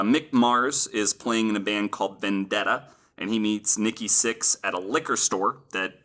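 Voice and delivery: normal talking voice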